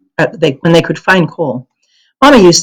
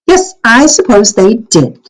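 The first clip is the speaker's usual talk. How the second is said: put-on higher, louder, slow childlike reply; little sister voice; 6 semitones higher, 4 dB louder, slower than usual. A narrator's reading. Kirsty earnestly agreeing with her sister, higher and slow. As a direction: answer brightly like a small child.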